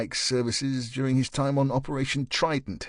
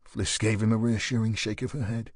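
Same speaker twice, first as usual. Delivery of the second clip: usual speaking voice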